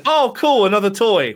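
a delighted kitten spotting a new toy; excited kitten voice. Retold or imitated. Host voices a kitten batting in the bedroom, high, loud and gleeful.

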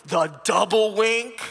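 slow, drawn-out incredulous echo; mock astonishment. Listener hooked on the wink story, mock astonished. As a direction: stretch it out in hammed-up amazement.

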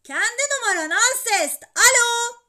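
high, very loud yell into the phone; boy shouting to be understood. A narrator's reading. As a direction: bellow to get through. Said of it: "diye bağırdım telefona".

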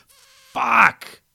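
a piercing cry of pain; pained scream. Acted. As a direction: scream as he's bitten. Bitten, screams.

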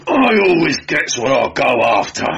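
bellow. A narrator's reading. Guardsman boasting to his prisoners, bellowing. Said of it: "bellowed the guardsman".

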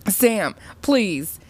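high, urgent plea; frightened mother pleading. A narrator's reading. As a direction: beg her to stop. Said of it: "heard her mother plead".

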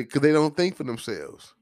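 ordinary delivery

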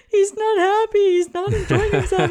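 high, loud tearful wail; bawling. Retold or imitated. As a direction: sob it out, voice cracking high and loud. Crying to mom about son.

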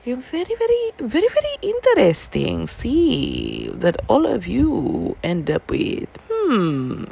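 playfully suspicious, savouring a theory; mock intrigued. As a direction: fan teasing a hidden pattern. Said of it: "But I was like".

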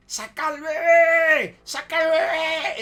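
high, drawn-out full yell; gritándole. Retold or imitated. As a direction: scream the order, stretching the last word. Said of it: "el ingeniero gritándole". (grito agudo y alargado, imitando al ingeniero)

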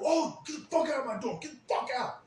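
high and loud, throwing him out; angry yelling. Retold or imitated. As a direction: bark them out the door. Throwing everyone out of the party.

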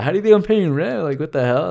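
mock-indignant son snapping at his parents; exasperated comeback. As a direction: throw it back at them, fed up and amused. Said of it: "I'm like".

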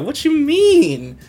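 high and slow, drawn-out scoff; cocky scoff. Retold or imitated. Yusuke answering Kuwabara before the fight, cocky high scoff. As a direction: stretch it out like it's obvious. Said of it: "Yusuke's like".

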